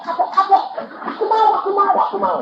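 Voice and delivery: high pleading surrender to God; imitating a doctor begging God, pitched high; desperate plea. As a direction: beg God like a man who gives in. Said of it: "dia kapok-kapok".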